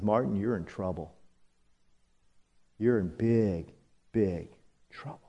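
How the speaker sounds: low, soft warning, fading almost to nothing; a quiet voice in his head sounding the alarm; inner warning voice. Retold or imitated. Conscience warning Martin he is in trouble, soft and low. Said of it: "the little red flag ... says".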